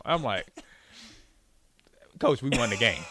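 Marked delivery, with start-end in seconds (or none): 2.2-3.1 laughing. Real, not acted.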